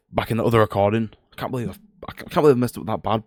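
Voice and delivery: normal talking voice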